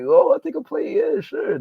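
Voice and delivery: high, eager acceptance; eager guest. Retold or imitated. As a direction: jump at the offer of food. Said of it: "I'm like".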